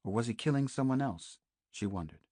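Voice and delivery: plain reading voice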